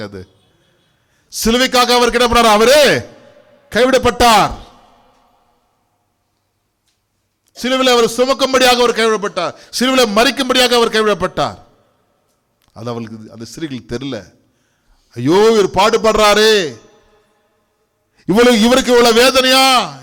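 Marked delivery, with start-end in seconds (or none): 1.4-11.6 high, loud, rushed preacher's cry; fervent preaching. Real, not acted. Preacher to the church, crying out that Jesus was forsaken, fervent and fast. 15.1-20.0 women's lament. Acted. Voicing the weeping women.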